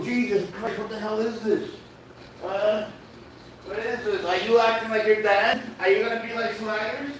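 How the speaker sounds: put-on high, soft baby-talk to the cat; high pet-talk voice.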